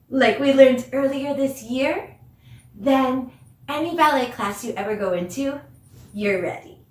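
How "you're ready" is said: usual speaking voice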